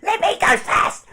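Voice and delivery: high, loud eager shout; shouted eager line. Acted. NPC shoves past the woman, shouting high. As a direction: bark it out, pushing ahead. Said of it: "he's like".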